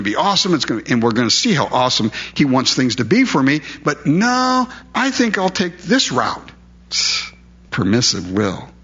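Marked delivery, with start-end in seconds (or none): 3.8-6.5 high, sarcastic "but no", then a smug shrug of a line; mock stubborn defiance. Preacher mocking a believer who ignores God, high mock defiance. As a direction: play the willful believer brushing God off, tongue in cheek. Mocking someone choosing own way.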